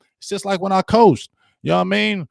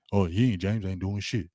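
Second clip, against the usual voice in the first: mocking critics; 7 semitones lower, 9 dB quieter than usual. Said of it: "That's what you be saying".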